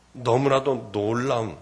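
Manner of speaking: normal talking voice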